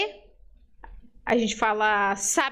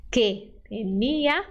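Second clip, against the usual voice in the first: slow model reading; 2 semitones lower, slower than usual. Shown as a demonstration. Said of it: "eu vou ler e você lê depois".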